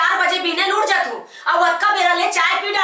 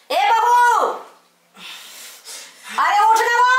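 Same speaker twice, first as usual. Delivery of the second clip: higher pitched impatient call; 8 semitones higher than usual. Acted. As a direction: urge her to get up. Calling daughter-in-law to wake.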